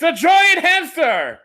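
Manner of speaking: high, loud, slow announcement; excited shout. Real, not acted. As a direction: announce it like a big reveal. Big reveal of the monster.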